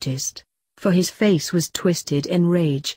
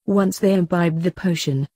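usual speaking voice

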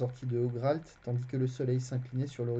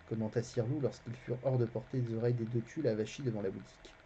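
ordinary delivery, nothing marked

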